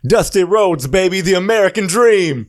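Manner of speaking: high, loud wrestler promo voice; Dusty Rhodes impression. Imitating a wrestler for his cohost, loud and high. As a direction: belt it out like a wrestling legend. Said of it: "You know who else kinda talks like that?".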